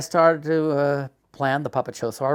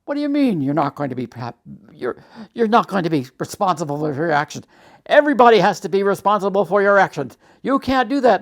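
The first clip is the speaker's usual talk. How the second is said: horrified; 6 semitones higher, 7 dB louder, faster than usual. Retold or imitated. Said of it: "The chaplain was horrified".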